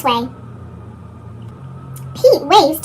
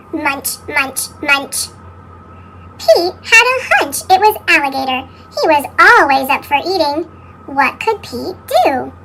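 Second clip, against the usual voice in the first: ordinary delivery, nothing marked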